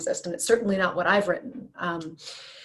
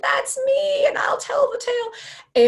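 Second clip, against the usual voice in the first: put-on much higher, breathy old woman's voice; old lady; 4 dB louder than usual. Old lady from Titanic recognizing herself, high frail voice. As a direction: play the frail old lady telling her tale. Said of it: "there's this old lady and she's like".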